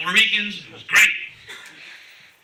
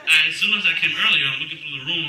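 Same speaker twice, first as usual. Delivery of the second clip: normal talking voice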